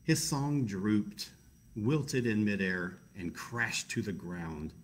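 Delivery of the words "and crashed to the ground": ordinary delivery, nothing marked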